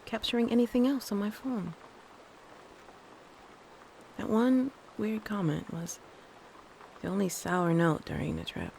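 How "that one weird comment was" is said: ordinary talk, nothing marked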